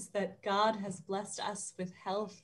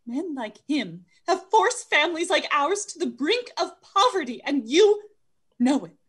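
angry; 5 semitones higher, 5 dB louder than usual. Acted. Angry speech against Scrooge.

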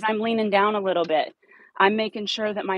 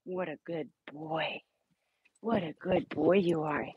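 slow, softer praise; 2 semitones lower, 7 dB quieter than usual. Real, not acted. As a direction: praise him gently and slowly.

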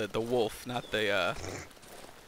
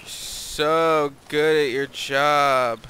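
sarcastic; 7 dB louder, much slower than usual. Real, not acted. Teasing a cohost's failure.

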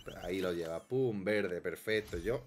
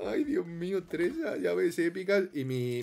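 mock despair; 7 semitones higher, 4 dB louder, slower than usual. Mock despair over lost money.

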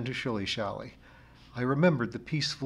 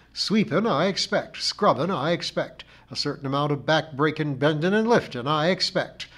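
higher, louder grumbling char lady; rhythmic peroration; 4 semitones higher, 6 dB louder than usual. A narrator's reading. Missus Pringle recites her chores to the narrator, rhythmic peroration. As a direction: build a rhythmic list of grievances. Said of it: "rising to heights of rhythmic peroration".

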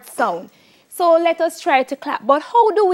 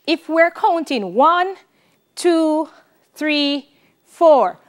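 ordinary talk, nothing marked

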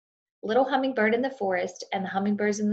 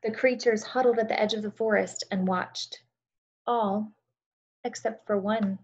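storytelling narration. A narrator's reading. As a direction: tell it like a bedtime story to a class. Reads story aloud to children.

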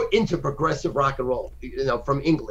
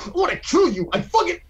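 mock rage. Imitating a stoner's sudden rage before he turns sweet. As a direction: spit it out like sudden fury. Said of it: "that's literally how insane it is".

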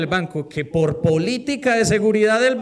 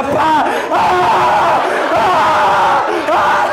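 mock child howling, loud and high; exaggerated fake crying cries, loud; hammed-up loud wailing; 7 semitones higher, 7 dB louder, much slower than usual. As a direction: wail loudly so the neighbours hear. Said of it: "lloraba duro al lado de la ventana".

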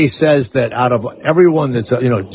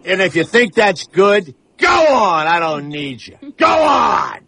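high, hammed-up yell at the camera; mock-furious dismissal, voice jumping up; shouted send-off; 6 semitones higher than usual. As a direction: bellow them out of the room. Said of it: "look at the camera and go like this".

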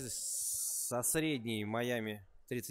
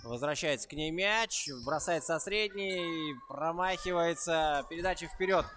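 louder, higher commentary riding the attack; live play-by-play; 4 semitones higher, 5 dB louder than usual. Real, not acted. Commentator follows a drive across the court, excited play-by-play. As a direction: call the fast break with rising energy.

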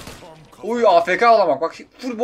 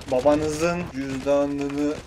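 mock solemn warning; 8 semitones lower, 6 dB quieter, much slower than usual. Mock preachy advice to players.

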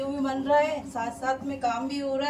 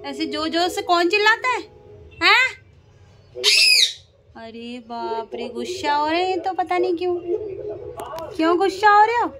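higher and louder, worked up at the noisy birds; excited scolding; 4 semitones higher, 7 dB louder than usual. Real, not acted. Owner to squawking parrots, loud and exasperated. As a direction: rise over the parrots' racket.

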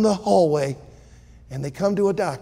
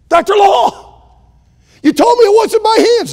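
frantic protest; 10 dB louder than usual. Acted.

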